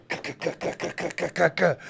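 rapid repeated "ka" in a thin, noisy rasp; imitated stabbing noise. Telling a story of a tripping friend stabbing his leg, mimicked stabbing sounds. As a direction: mimic the jabs with quick throat clicks.

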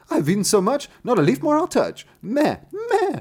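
very high, loud goat voice; bleating goat. Acted. Goat answers the youth at night, high bleating voice. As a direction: bleat the rhyme shrilly like a goat.

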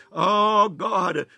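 normal talking voice

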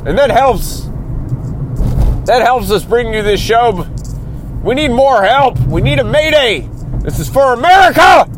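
rising, pitch climbing into a hammed-up shout; mock upset rant. Host begging listeners, building to a mock shout. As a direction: plead for America like a desperate telethon host. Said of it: "I'm sorry to get so upset".

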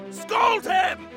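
high shouted command; announcer shout. Acted. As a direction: bark the order like a radio host. Announcer urging listeners.

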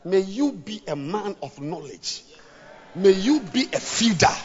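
ordinary talk

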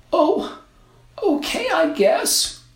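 put-on very high, slow girl voice; unsure little girl. A narrator's reading. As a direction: answer like an unsure child. Girl shrugs.

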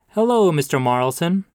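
hearty pastor greeting. Acted. Pastor greets the grieving grandmother outside church, hearty. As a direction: sing out her name like a beaming clergyman.

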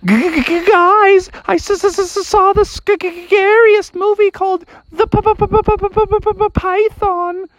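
high-pitched, scared stammering frog; petrified squeal, stuck on the consonants; terrified stutter. Acted. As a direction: stammer on every first sound in a high fright. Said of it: "he was so scared".

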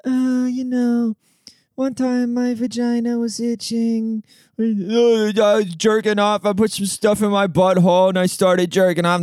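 high, slow, put-on mopey voice; mocking sad comedian. Mocking comics who overshare onstage, high slow whine. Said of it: "Bunch of people stand up there like".

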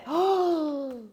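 high, loud gasp of delight; excited gasp. Real, not acted. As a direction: gasp in joyful surprise. Pet succeeds.

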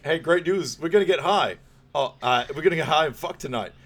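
loud and high, blurting the news over the phone; loud party call. Retold or imitated. The dad blurting to the phone, loud and high. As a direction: shout it down the line, then catch yourself mid-sentence.

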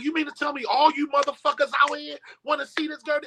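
yelled high and hard at the crowd; retold yell. Telling the crowd off at the hospital, yelling high. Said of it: "I never yelled that loud in my life".

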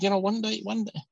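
high-pitched, badly faked Scouse; Liverpool accent. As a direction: imitate a footballer's Liverpudlian lilt, badly. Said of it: "Oh, sorry, that's really bad".

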